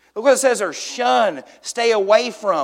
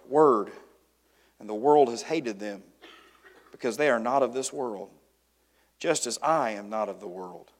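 low, measured reading with long pauses; solemn scripture reading; 5 semitones lower than usual. A narrator's reading. Preacher reads Jesus' prayer for the disciples, solemn and low. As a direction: read the prayer gravely, letting phrases fall away.

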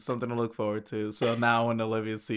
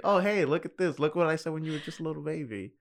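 higher, lilting mock showing-off to a child; playful parent voice; 5 semitones higher than usual. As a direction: show an old clip to your kid, playfully. Future parent teasing grown child.

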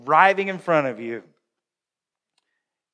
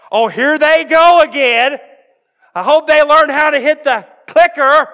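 loud, high, hammed-up irritation; exasperated grumbling out loud; 9 semitones higher, 11 dB louder than usual. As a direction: complain loudly about that driver. Acting irritated driver behind them.

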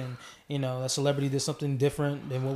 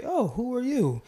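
mock smart speaker voice, higher; lightly voiced Alexa, higher pitched; imitating Alexa; 8 semitones higher than usual. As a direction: play the confused voice assistant. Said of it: "it would say".

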